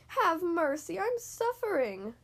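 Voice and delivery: high, drawn-out pleading wail. A narrator's reading. Fox in pain begging for mercy, acted wail. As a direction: wail it high, slowing toward the end. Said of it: "the fox wailed".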